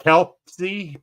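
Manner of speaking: Kelpsy broken slowly into KELP and SEE; Kelpsy with a gap before the final see; really slow pronunciation. Real, not acted. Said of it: "I gotta say it really slow".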